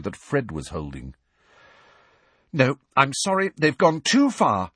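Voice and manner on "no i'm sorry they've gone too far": higher, mildly furious protest; a touch of outrage, pitched up; indignant. A narrator's reading. Hermione objects as she stands up, indignant. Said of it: "looking positively furious".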